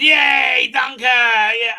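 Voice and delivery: loud, high, drawn-out mock cheer. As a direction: whoop like an eager mob, hammed up. Crowd cheering the dunking, acted.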